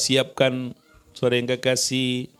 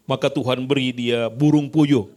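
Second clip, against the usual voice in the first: ordinary delivery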